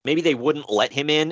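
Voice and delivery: ordinary talk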